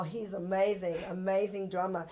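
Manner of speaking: ordinary talk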